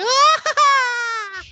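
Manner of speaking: high, loud, laughing mock shriek like a woman; hammed-up womanly shriek, laughing; mock high scream. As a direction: imitate a guy screaming like a woman while laughing. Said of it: "like a woman as he's screaming".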